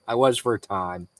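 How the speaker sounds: ordinary talk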